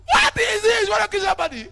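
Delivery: loud high outburst; shouting. Retold or imitated. Husband yelling at the driver who hit the car, loud. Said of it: "So should I shout".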